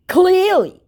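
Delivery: very high, loud mocking echo; mocking scammer. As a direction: throw the word back like the scammer. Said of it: "he mocks me".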